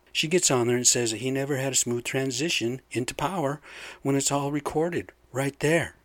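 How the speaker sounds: ordinary talk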